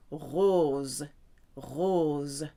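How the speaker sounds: French rose with a clear Z sound at the end. Shown as a demonstration. Teacher models the word for pink, rose with Z sound. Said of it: "S between two vowels gives the S a Z sound".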